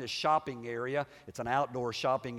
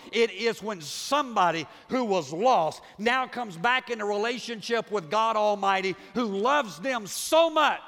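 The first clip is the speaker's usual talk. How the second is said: full-force preaching, louder and higher; 6 semitones higher, 6 dB louder than usual. Acted. Pastor preaching hard to his congregation, loud and high. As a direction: drive it home to the congregation.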